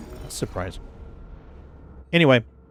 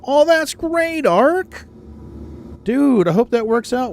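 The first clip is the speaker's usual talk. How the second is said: excited; 11 semitones higher than usual. Real, not acted. Cheering friend's good news.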